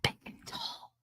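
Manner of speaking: breathy mock whisper of a comment. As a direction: read the comment in a hushed whisper. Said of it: "Uh, whispers".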